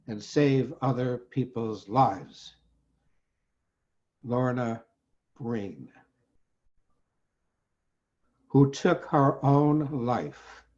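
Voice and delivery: slow recitation with long, weighted pauses; elegiac poetry reading. Acted. Poet honors the doctor who died, slow elegiac reading. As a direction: let each phrase land, fading to a hush at the close. Poem's closing lament.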